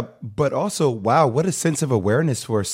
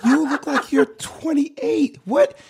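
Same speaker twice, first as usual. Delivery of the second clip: much higher, laughing disbelief; amazed. Real, not acted. Host to Kelly, laughing amazement at her youth. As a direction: gush in disbelief.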